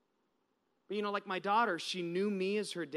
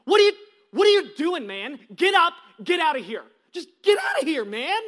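loud, high furious yell; reenacted protective yell; screaming back; 8 semitones higher, 13 dB louder than usual. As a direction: scream him away from your child. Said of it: "I screamed back".

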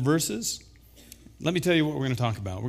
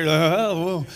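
mock hesitation; 2 semitones higher, much slower than usual. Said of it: "You guys aren't as quite as loud there".

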